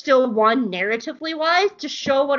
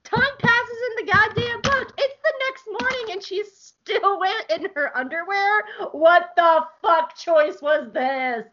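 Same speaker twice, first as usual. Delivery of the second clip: incredulous rant; 8 semitones higher, slower than usual. Real, not acted. Ranting about the comic.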